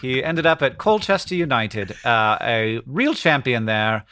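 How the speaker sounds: put-on British newsreader accent; mock BBC presenter, English accent. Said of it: "this is like B B C voice".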